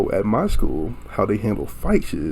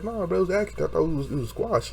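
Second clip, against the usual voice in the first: higher, softer mock protest; retold protest; 8 semitones higher, 5 dB quieter, faster than usual. Telling a classmate it was an accident, retold protest. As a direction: reenact a high-pitched, hushed denial.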